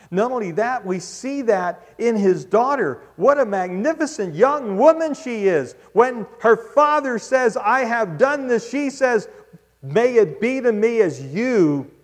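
loud, high preaching swell; preaching at full force. Acted. Preacher praising the daughter to the church, loud and high. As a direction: lift it up in praise.

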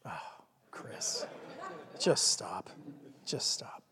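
whispered mock exasperation; soft whisper. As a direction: hush it, gently fed up. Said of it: "God's probably like".

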